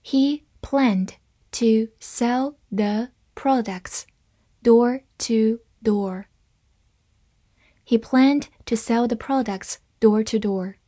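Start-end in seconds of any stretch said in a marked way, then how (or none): 0.0-6.2 slow, separated words; slow reading. Shown as a demonstration. Teacher leads learners through the sentence, slow reading. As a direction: read it word by word, slowly. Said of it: "好，慢慢来读".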